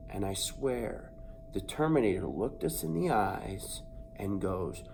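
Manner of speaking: ordinary delivery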